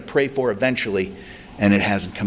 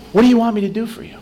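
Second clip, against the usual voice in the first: Jesus asking; 10 semitones higher, 4 dB louder, faster than usual. Retold or imitated. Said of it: "Jesus asked him".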